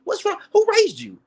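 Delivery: high-pitched, indignant questions; exasperated high-pitched rebuke; incredulous scolding. Real, not acted. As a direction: demand answers, voice leaping way up. Scolding the woman he addresses.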